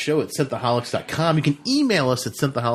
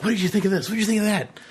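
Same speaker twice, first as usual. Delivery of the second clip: pitched-up, bright-eyed fan interviewer voice; eager interviewer; 9 semitones higher, faster than usual. Pretend. Host at a con quizzing fans, high eager interviewer. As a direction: play a chirpy con reporter with a mic. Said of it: "run around with my recorder like".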